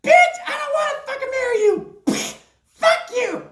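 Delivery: high, indignant princess voice; sassy princess. Retold or imitated. Princess Peach refusing Bowser's proposal, high and indignant. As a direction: snap back as a fed-up princess. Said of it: "Princess Peach is like".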